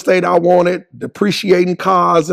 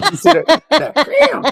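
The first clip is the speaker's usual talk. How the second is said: laughing; 13 semitones higher than usual. Real, not acted. Laughing while acting out son's hit.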